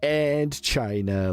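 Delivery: very slow, high Trump voice; Trump impression. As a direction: draw out China like Trump. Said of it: "He's like".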